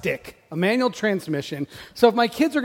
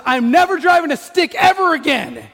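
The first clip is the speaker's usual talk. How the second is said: high, loud mock tantrum; indignant retold outburst, pitched up; mad daughter; 8 semitones higher, 5 dB louder than usual. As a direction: imitate a furious teen swearing off for good. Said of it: "She's so mad".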